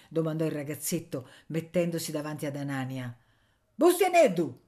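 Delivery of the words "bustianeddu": high, loud call of a name; shouted. A narrator's reading. Miller yelling at a boy, shouted. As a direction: yell sharply at the boy. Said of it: "gridò il mugnaio".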